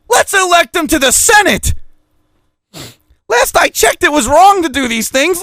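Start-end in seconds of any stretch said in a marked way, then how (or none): 0.1-1.7 high mocking sarcasm; sarcastic. Real, not acted. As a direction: say it with heavy sarcasm. Sarcastic about corrupt politician.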